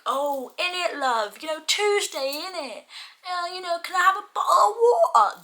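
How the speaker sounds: high, loud mock British accent; exaggerated British accent. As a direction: caricature an American's bad British impression. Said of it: "the heavily exaggerated British accent".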